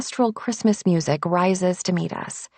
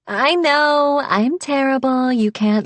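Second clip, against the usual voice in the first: playful; 6 semitones higher, 4 dB louder than usual. Acted. Alexis teasing her wife.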